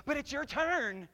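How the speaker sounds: put-on high, slow whining kid protesting; whiny sibling. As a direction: complain like a child whose turn was skipped. Sibling quarrel acted out.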